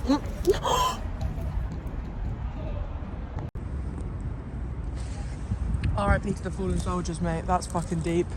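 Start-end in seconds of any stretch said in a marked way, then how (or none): 0.3-5.9 long high breathy gasp of delight. Real, not acted. Stunned by the sandwich, a long gasp. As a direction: draw in a long amazed gasp.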